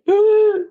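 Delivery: high, loud, sobbing gasp; mock sob gasp. Teasing her co-host about crying on the train, mock sob gasp. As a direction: gasp like breaking into tears. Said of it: "You were like".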